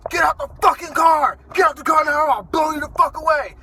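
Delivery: loud, high, furious cop yelling orders; cop shouting. Retold or imitated. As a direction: bark the order like a cop with his gun drawn. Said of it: "This guy's screaming for me to get out the car".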